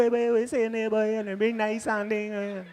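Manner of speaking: very high, lilting Trini sing-song; sing-song Trini accent. Shown as a demonstration. Trini showing off the accent, high hammed-up sing-song. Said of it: "is very sing-song".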